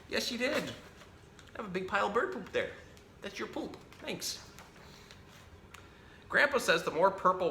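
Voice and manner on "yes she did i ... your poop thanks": much higher cooing voice; high sing-song pet talk. Real, not acted. Talking to the bird.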